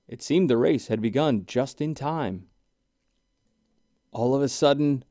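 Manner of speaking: plain reading voice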